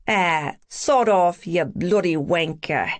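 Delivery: slow, mock-British retort; British accent. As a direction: swear back in a put-on British accent. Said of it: "in my best British accent".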